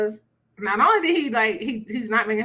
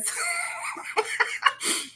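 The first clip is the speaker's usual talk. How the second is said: laugh; 6 dB quieter than usual. Real, not acted. Laughing at women's habit.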